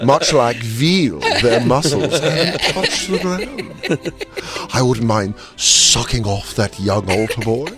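slow, savoring British villain; British villain accent. Retold or imitated. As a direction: purr it like a posh British villain. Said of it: "villains British accent".

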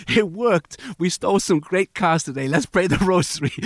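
loud, high, gleeful mock boasting; triumphant thieves. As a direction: imitate car thieves cheering their success. Acting out triumphant car thieves.

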